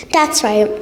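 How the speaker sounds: ordinary talk, nothing marked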